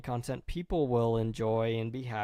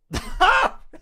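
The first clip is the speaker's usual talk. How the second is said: high, loud laugh of disbelief; real embarrassed cackle; exasperated laugh; 9 dB louder than usual. Real, not acted. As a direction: laugh helplessly at yourself. Laughing at own blunder.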